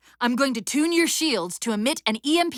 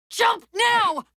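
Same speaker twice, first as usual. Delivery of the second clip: urgent shout; 9 semitones higher than usual. Acted. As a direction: shout it across the gap. Urgent order mid-combat.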